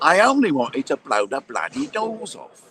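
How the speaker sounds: a light stab at a famous Cockney film star, slowed; lightly voiced London film star, slow; Michael Caine impression. Joking to his co-host, a light slow film star impression. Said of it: "That's Michael Caine".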